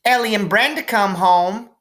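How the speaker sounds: put-on high, eager little girl calling; little sister voice. Little sister Maybell calling to her brother, high and eager. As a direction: pipe up like a small sister.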